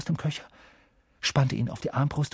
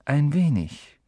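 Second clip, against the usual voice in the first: sighed echo of the answer; musing, a touch melancholy; sighing; 4 semitones higher, 3 dB louder, slower than usual. A narrator's reading. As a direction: repeat it with a gentle sigh. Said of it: "seufzte Ronan".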